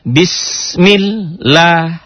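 "bismillah" drawn out and chanted slowly; slow recitation. Shown as a demonstration.

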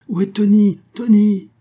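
loud, high-pitched call for someone missing. A narrator's reading. Family calling Tony to the waiting carriage, loud calling.

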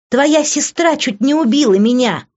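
high, heated protest; outraged outburst; angry accusation. Acted. Enraged Liv turns on Vince.

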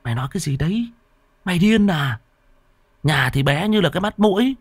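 put-on young man scolding his foolish friend; exasperated outburst. A narrator's reading. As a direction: berate your friend in disbelief. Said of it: "trợn mắt".

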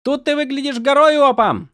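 high, loud, delighted woman's voice; exclaiming. Acted. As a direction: cry out with cheerful delight. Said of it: "воскликнула золотоволосая камнестражница".

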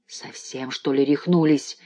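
ordinary delivery, nothing marked

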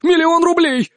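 high, urgent desperate offer; blurted out hopefully. A narrator's reading. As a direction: blurt it out in sudden hope. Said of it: "с надеждой выпалил он".

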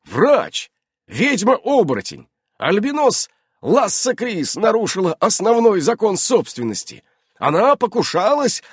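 loud, high formal denunciation; solemn. A narrator's reading. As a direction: proclaim the charges with great ceremony. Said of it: "торжественно произнёс Иннокентий".

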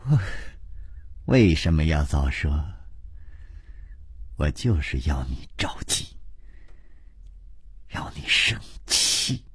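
low, soft and coquettish; coy, lip-bitten teasing. A narrator's reading. Princess enjoys making the hero fret, coy and teasing. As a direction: tease softly, fading almost to a whisper. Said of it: "她咬着嘴唇说".

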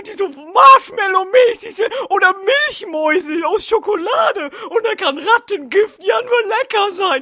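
put-on a dim, happy boy bragging about candy; silly Neville voice. Neville cheerfully tells Harry he ate rat poison.

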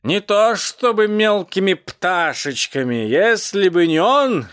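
put-on high, slow, drunken rasped lament; melodramatic, strained cat cry; rasping cat. A narrator's reading. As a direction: wail it out slowly and hoarsely. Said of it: "прохрипел он".

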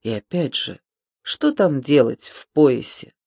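usual speaking voice

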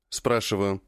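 usual speaking voice

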